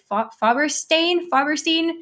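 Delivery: ordinary talk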